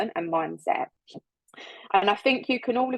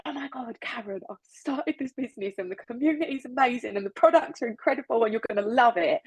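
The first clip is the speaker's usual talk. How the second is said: higher, gushing with excitement; excited pitch; 3 semitones higher than usual. Shown as a demonstration. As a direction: sell it bursting with energy to a friend. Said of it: "If I go to Karen and go".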